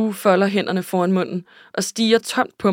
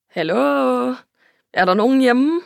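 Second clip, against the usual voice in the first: higher, rising sing-out call; playful calling out; 5 semitones higher, 4 dB louder than usual. A narrator's reading. Narrator's friend jokingly calls to silent Sue, playful calling out. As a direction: call out to see if anyone's in.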